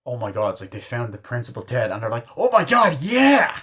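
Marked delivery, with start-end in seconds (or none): 2.4-3.6 high, loud whoop of excitement; mock excited yell. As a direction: act out a thrilled crowd, voice shooting up. Retold crowd thrilled by gruesome news.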